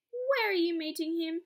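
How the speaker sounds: high, loud anxious question; jittery fox voice; nervous fox. Acted. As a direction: fox getting worried.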